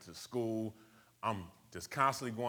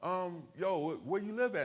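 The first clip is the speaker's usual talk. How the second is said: much higher, hammed-up hesitant gang voice; gang member backing off; 11 semitones higher than usual. Gang member easing off the teen who claims Brooklyn, high mock voice. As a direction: back down with a high, unsure okay-okay.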